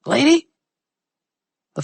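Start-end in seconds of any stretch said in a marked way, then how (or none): 0.1-0.5 high, loud call of Lady?; calling through a door. Acted. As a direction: shout Lady? through the shut door, rising. Blackmailer calling through closed door.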